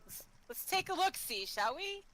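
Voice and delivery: playful character voice. Acted. Playing the spelunker character.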